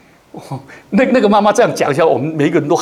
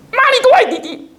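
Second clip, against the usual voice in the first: put-on much higher, laughing child's complaint; angry little child. Pastor mimicking a child accusing mum, in a high squeaky voice. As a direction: squeak it like a sulky toddler, half laughing. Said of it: "才四岁多就大骂她的妈妈".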